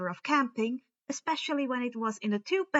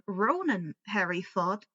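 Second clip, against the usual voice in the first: usual speaking voice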